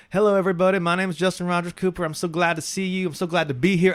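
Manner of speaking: put-on loud, high, gushing Southern kid introduction; happy new kid with a thick Southern accent. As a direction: beam it out eagerly in a Southern accent. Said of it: "My Southern accent was so thick".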